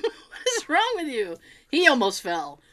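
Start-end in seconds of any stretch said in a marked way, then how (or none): 0.0-1.4 laughing scold. Retold or imitated.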